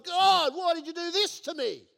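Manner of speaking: high, loud pleading wail; mock cry to God. Preacher voicing a failed businessman crying out, loud mock wail. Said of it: "Then we're saying to God".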